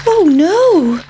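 high, loud little pancake voice; squeaky alarmed pancake; pancake's dismay. A narrator's reading. Pancake panicking at being eaten, high and loud. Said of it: "thought the pancake".

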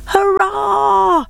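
high, jubilant hurrah; cheering. A narrator's reading. Animals to the tortoise who won, cheering. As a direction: cheer the winner home in a high voice. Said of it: "the animals cheered".

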